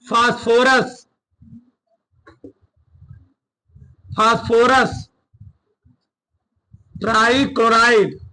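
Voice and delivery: spaced out, repeating each phrase for writing; teacher reading notes aloud word by word; slow dictation. Shown as a demonstration. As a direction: dictate slowly so they can copy.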